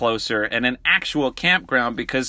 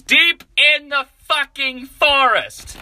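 high, loud, slowed complaint; exasperated exclaim; 5 dB louder, slower than usual. Real, not acted. Telling viewers the GPS lost service, high exasperated wail. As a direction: stretch it out in disbelief.

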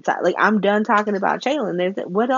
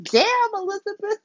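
react, impressed and scandalized; impressed shock. Retold or imitated. Vlogger reacting to Elizabeth's insult, high and impressed. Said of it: "I was like".

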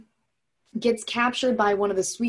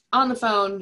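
normal talking voice

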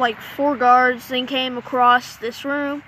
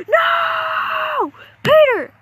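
anguished cry; 12 semitones higher, 4 dB louder than usual. Pretend. Friend shot, begs him not die.

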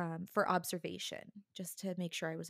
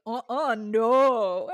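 much louder, higher flat no; emphatic refusal; 4 semitones higher, 13 dB louder, much slower than usual. Retold or imitated. Instructor on the phone refuses the hearing test, loud. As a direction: shut it down hard. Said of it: "And Melissa was like".

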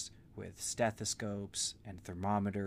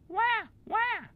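very high loud squeaky wah; parrot copying baby cry; 10 dB louder than usual. Acted. Parrot kitty copying the baby's cry, very high. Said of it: "it also cried".

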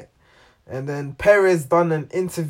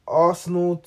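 ordinary delivery

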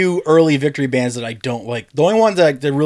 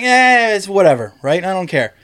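dismissive shrug; 6 semitones higher, 3 dB louder than usual. Retold or imitated. Retelling his indifferent first reaction.

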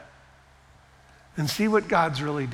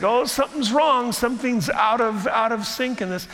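startled realization; 6 semitones higher than usual. Retold or imitated. Preacher voicing someone hearing the washer bang, higher and alarmed. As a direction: react like a washer just started banging. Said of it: "And it's like".

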